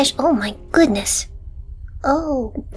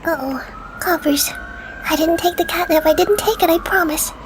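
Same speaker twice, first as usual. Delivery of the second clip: ASMR whisper; 3 semitones higher than usual. Real, not acted. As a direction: whisper close to the mic. Whispers throughout the video.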